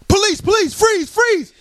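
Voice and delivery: barked high police order; drill sergeant. Retold or imitated. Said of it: "he sounded like Sergeant Slaughter".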